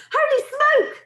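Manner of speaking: high, loud startled yell. A narrator's reading. Cook feeling the mouse climb his leg, yelling. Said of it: "the man was yelling".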